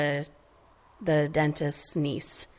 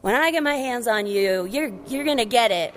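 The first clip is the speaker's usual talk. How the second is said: high scolding father, fading down; mock angry dad; 6 semitones higher than usual. Marlin scolding Nemo, hammed-up angry dad. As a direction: start sharp and high, then settle into the threat. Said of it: "And Marlin was like".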